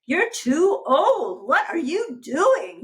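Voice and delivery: high, loud, slow scolding voice; harsh critical ballerina. Retold or imitated. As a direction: scold like a mean little critic. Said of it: "she is harsh and mean and critical".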